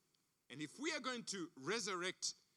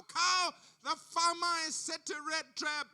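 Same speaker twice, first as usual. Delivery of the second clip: higher, louder call to the cow; 6 semitones higher, 6 dB louder, slower than usual. Acted. Rat shouting a warning to the cow. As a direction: call out the danger across the farm.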